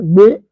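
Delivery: a loud gagging blech at the thought of liver; disgusted blech. Real, not acted.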